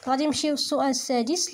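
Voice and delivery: ordinary talk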